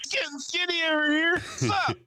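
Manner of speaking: high, squeezed held-in voice; strained mock-casual voice. Comic curled in his chair pretending to be skinny, strained. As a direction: talk while sucking in your gut. Acting himself sucking in his gut.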